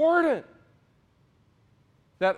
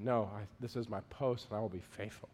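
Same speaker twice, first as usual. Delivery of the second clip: low, much softer steady reply; a guard calmly refusing to leave his post; quiet, unmoved soldier's answer; steadfast soldier; 12 semitones lower, 11 dB quieter, faster than usual. Retold or imitated. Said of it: "And he goes".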